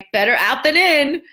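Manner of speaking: high, loud singsong mom line; cheery mom voice. Retold or imitated. Mother cheering on her daughter after she coughs, high singsong. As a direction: chirp it brightly to your kid. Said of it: "And I'm like".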